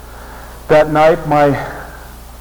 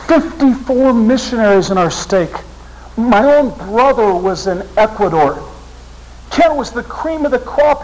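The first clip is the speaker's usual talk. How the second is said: much higher, strained and near tears; pleading against the news, voice climbing; stunned grief; 8 semitones higher than usual. Real, not acted. Grieving over the young missionary killed, high strained voice. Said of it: "I, I was stunned".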